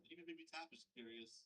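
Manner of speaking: ordinary talk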